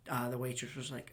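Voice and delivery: normal talking voice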